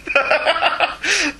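high chuckling laugh; laughter. Real, not acted. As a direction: laugh at your own cheeky joke. Still laughing at own joke.

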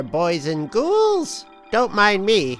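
ordinary delivery

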